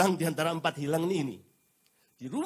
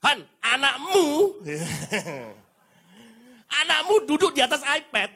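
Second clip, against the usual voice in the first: nagging wife; 8 semitones higher, 4 dB louder than usual. Retold or imitated. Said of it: "ada hari-hari tertentu ketika saya pulang".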